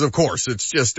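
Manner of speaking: usual speaking voice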